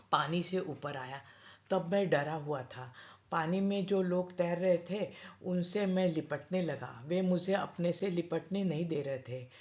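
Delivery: ordinary delivery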